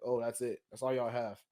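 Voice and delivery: ordinary talk, nothing marked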